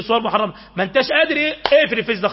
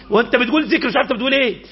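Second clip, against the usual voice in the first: ordinary delivery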